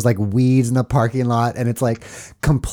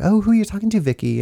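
higher mock mom voice; imitating the mom; 10 semitones higher, faster than usual. Retelling the mom calling to her daughter, higher mock voice. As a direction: play the mom calling down, curious.

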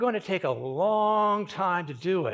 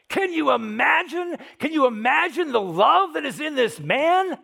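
high, loud, swelling with amazement; impassioned preaching; 8 semitones higher, 7 dB louder than usual. Real, not acted. Preacher marvelling to congregation at love for torturers, impassioned. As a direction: cry it out in awe at such love.

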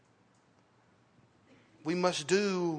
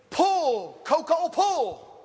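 loud, higher call, slow and drawn out; 8 semitones higher, 6 dB louder, much slower than usual. Retold or imitated. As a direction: holler the command slowly across the field. Farmer calling out to horse.

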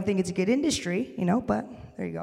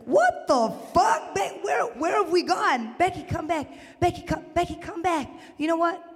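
high-pitched frantic calling back; mock alarmed disbelief; 8 semitones higher than usual. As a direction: cry out in comic alarm, voice way up. Acted panic calling Becky back.

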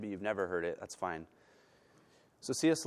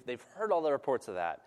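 ordinary delivery

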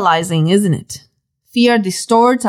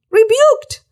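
"Rebuked!" much higher, rising at the end; emphatic exclamation; 11 semitones higher than usual. Real, not acted. Preacher repeating the word for the congregation, exclaimed. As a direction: toss out "Rebuked!" again in amazement.

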